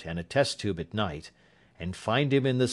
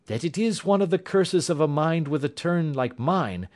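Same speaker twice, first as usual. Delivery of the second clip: lightly raised, confident detective voice; Holmes, calm and assured; 8 semitones higher than usual. A narrator's reading. Holmes muses gravely.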